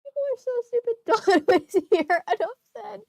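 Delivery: high, sing-song sneer with a laugh; mock the line in a squeaky put-on voice. Reader sneering at the diary's insult, high and mocking.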